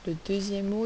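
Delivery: usual speaking voice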